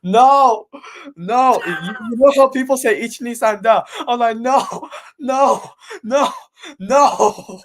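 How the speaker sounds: loud, anguished "No!"; retold screaming. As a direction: yell it out in protest. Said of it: "audibly screaming".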